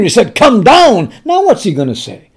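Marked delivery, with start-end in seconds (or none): 0.3-1.1 a firm, mildly angry order from the king; angry command. Retold or imitated. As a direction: bark it as the annoyed king, louder. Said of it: "first he was angry".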